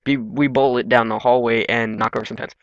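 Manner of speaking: ordinary talk, nothing marked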